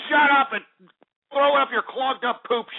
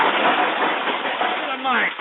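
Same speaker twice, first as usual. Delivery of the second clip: "Give me the mic." stretched very slowly; "Give me the mic." said at a crawl; drawn out; 3 semitones higher, much slower than usual. Real, not acted. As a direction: drag every word of "Give me the mic." out. Host repeats demand, drawn out.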